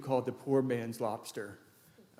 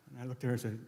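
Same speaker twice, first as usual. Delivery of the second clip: ordinary talk, nothing marked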